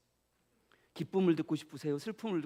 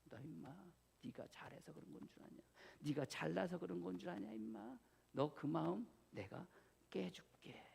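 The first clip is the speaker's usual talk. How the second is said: much softer, stern whispered scolding; hushed rebuke; 12 dB quieter than usual. Pretend. God quietly rebuking the proud pastor, whispered. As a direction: scold him quietly from within.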